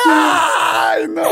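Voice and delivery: high, crying ay no in disgust. Retold or imitated. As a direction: wail it like the rat is under you. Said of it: "y yo así".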